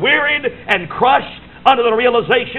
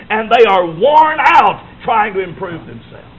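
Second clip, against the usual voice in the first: each word pounded out slowly; slow emphatic shout; 3 dB louder than usual. Real, not acted. Preacher to congregation: worn-out sinners, slow pounding shout. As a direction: hammer every word home.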